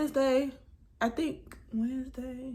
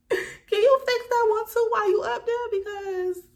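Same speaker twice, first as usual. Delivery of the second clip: laughing plea; 13 semitones higher, 9 dB louder than usual. Retold or imitated. Re-asking roofer while laughing.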